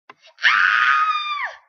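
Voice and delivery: very high, loud terrified shriek; startled high scream; frightened scream. Acted. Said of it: "Grr screams and runs away in fear".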